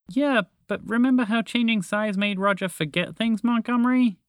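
high, anxious mouse voice; mildly fretful, high-pitched reminder; worried. Acted. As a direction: raise a worried objection. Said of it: "Montgomery the Mouse added with worry".

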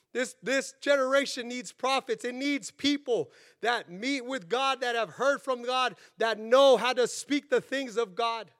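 punchy preacher's proclamation; fervent preaching. Real, not acted. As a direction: preach it with urgent conviction. Preaches with force.

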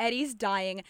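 usual speaking voice

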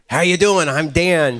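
low, damaged rasping voice; a creepy low voice through a wrecked larynx; lacerated larynx voice. Retold or imitated. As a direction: croak it out through a ruined throat. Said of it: "with his lacerated larynx".

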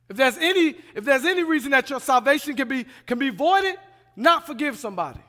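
loud, high, urgent sermon delivery; forceful preaching. Real, not acted. Preacher warning the church about not forgiving, loud and impassioned. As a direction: drive the warning home at full force.

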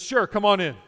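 lightly voiced innkeeper blurting a welcome; pageant innkeeper. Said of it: "the innkeeper says".

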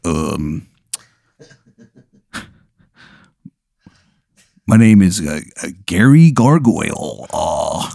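a hint of a rasping gargoyle; gravelly gargoyle voice. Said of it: "he's like".

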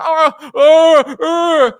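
high loud spooky mock wails; hammed-up ghostly ah cries; mock scary wail. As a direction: wail like you're scaring the audience. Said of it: "He scares the shit out of his audience".

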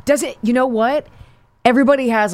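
ordinary delivery

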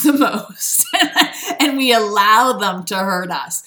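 laughing through the words; helpless burst of laughter, carrying into the next phrase; real laughing. Real, not acted. Bursts out laughing at own remark.

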